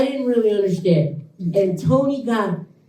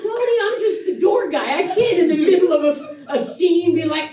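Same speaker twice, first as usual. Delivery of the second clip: laughing protest; 10 semitones higher than usual. Retold or imitated. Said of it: "I go".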